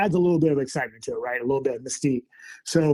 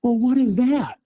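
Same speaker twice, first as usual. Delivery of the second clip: higher, anxious mock wife question; alarmed wife; 9 semitones higher than usual. Imitating his wife asking about the sounds, higher and uneasy. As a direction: voice the wife's worried question up high. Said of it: "And so now my wife's going".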